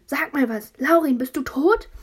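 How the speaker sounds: high loud frantic pleading; panicked shout. Acted. Brother screaming to see if his brother is alive. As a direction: beg him to answer, terrified. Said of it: "hat Vincent gebrüllt".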